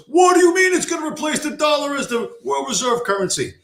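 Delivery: high, whining mock panic; panicked stockbroker. Mocking a Wall Street broker's panicked whine. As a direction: mimic a frightened broker losing it. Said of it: "The panic in his voice".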